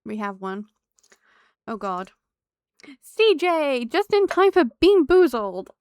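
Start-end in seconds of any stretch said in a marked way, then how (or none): 3.0-5.7 high, loud cheerful welcome; excited greeting. Real, not acted. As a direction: call out a happy hello. Greeting a new viewer.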